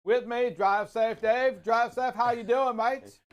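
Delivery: lightly put-on Australian host voice; mock Australian accent. Host introducing his co-host in a mock Australian accent. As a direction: introduce your co-host with a jokey Aussie twang. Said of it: "both of you have different accents".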